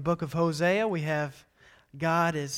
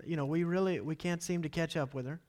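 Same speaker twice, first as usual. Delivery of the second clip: usual speaking voice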